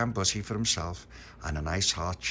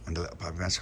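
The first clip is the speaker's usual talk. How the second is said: usual speaking voice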